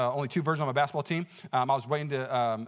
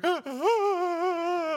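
high mock sobbing wail; hammed-up crying; 12 semitones higher than usual. Preacher showing his mirror-watching cry to church, hammed-up high sob. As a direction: imitate an ugly cry, long and high. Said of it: "one of those cries where you take a look in the mirror".

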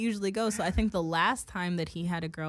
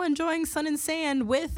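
mock dad post; 9 semitones higher than usual. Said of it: "he was like".

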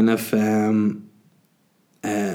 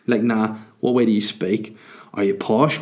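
normal talking voice

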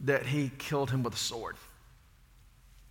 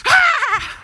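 a sudden high shriek of fright; startled wife's scream while throwing the axe at the snake; mock scream; 13 dB louder than usual. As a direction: shriek as she swings the axe. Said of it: "She's like".

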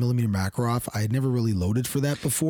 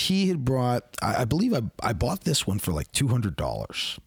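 usual speaking voice

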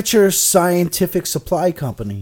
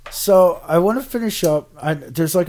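ordinary delivery

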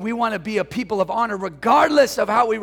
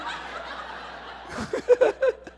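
high breathy laugh. Real, not acted. Laughing after his own joke.

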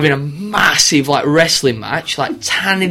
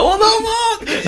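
high, slow squeal through laughter; cracking up mid-word; laughing; 4 dB quieter, much slower than usual. Retold or imitated. Retelling absurd wallet prank.

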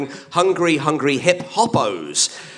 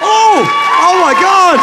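high, loud shocked cry; surprised shout; 13 semitones higher, 7 dB louder, slower than usual. Real, not acted. Reacting to applause.

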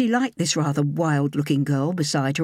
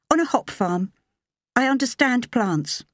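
higher, lighter woman's voice; young woman voice; 8 semitones higher than usual. A narrator's reading. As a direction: voice her higher and clear.

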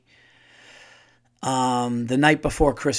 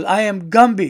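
higher, louder cranky Gumby; imitating Gumby; 5 semitones higher, 7 dB louder than usual. Comedian quoting Gumby demanding respect, higher and louder. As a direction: bellow it like a washed-up claymation star. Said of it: "He goes".

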